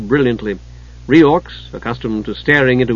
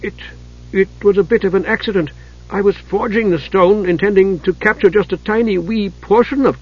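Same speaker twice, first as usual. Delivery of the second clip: stammering dwarf; 7 semitones higher than usual. A narrator's reading. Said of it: "but he stammered on".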